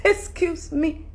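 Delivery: ordinary delivery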